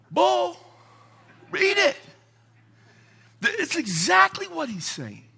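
high, loud exasperated preaching; indignant outburst. Real, not acted. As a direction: scoff at the claim. Preacher rebutting critics.

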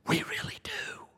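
secretive whispered insistence; hushed mock pleading. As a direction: lean in and whisper the claim. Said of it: "Just ask them".

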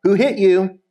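read-aloud mocking challenge; mocking demand. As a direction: jeer at a blindfolded prisoner, mildly. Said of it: "began mocking and beating him".

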